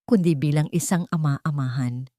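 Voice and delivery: plain reading voice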